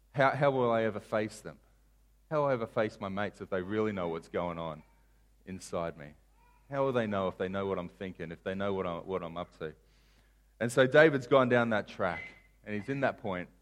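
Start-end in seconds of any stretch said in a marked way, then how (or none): 0.1-9.7 quiet dread. Acted. David voiced, dreading being found out.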